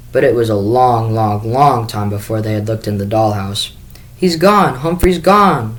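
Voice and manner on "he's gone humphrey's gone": high, loud, drawn-out howl of grief. Acted. Sophie howls to her family that her hamster is gone, loud and high. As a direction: howl it out, much higher and louder. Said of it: "Sophie howled".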